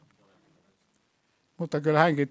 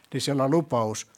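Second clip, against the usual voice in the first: ordinary delivery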